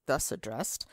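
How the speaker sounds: usual speaking voice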